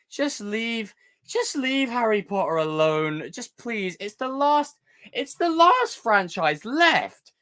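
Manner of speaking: high, pleading rant, slow in places; exasperated rant. Real, not acted. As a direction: whine at the studio to leave it alone. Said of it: "I'm gonna go on a bit of a rant".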